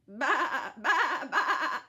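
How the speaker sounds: loud high baas; sheep bleating. A narrator's reading. Sheep answering the kitten with loud bleats. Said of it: "And the sheep went".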